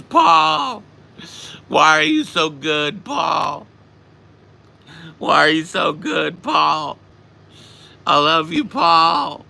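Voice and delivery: high, loud maudlin wailing; drunk T J. Retold or imitated. Drunk friend declaring love from the couch, imitated loud wail. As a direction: gush like an overwrought, adoring drunk. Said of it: "describe a drunk T J".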